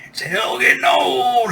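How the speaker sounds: high, slow Kentucky grandma cry; Kentucky accent. Retold or imitated. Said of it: "with her, uh, Kentucky accent".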